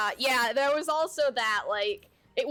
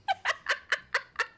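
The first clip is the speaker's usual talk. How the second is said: shrieking giggle, much higher; high burst of laughter. Real, not acted. Laughing at the missing sink.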